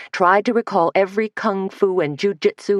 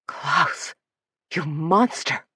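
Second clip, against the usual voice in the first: seething through clenched teeth; 3 dB quieter, much slower than usual. Acted. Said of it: "Suzanne seethed through clenched teeth".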